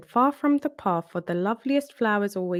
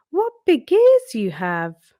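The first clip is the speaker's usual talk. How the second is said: slow, louder, wide-eyed amazement; amazed; 4 semitones higher, 8 dB louder than usual. A narrator's reading. Girl marvels at the wolf's big ears, slow and amazed. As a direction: stretch it out in wonder.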